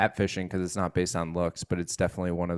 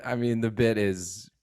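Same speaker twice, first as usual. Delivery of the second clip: usual speaking voice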